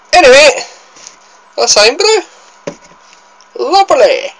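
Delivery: bright sign-off. Acted. Wrapping up the taste test.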